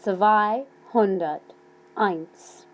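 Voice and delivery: slow dictation of Zweihunderteins; slow and clear. Shown as a demonstration. Teacher dictating a number slowly to students. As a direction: Zweihunderteins drawn out for learners to write down. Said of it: "I'll say them slowly".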